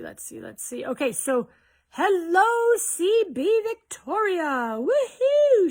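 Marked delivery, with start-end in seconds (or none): 1.9-4.8 high, loud, drawn-out hello; excited greeting. Real, not acted. Host welcoming C B Victoria to the live, high and slow. 4.8-5.7 very high, loud whoop; excited cheer. Real, not acted. Host cheering C B Victoria, a high loud whoop.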